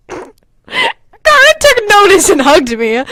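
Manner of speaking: high, slow laughing read; giggling through the sentence. Real, not acted. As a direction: crack up while reading. Laughing reread.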